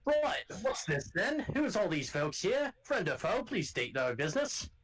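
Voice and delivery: gruff Scottish guard calling out to strangers; rough Scottish accent. Acted. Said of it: "Good accent".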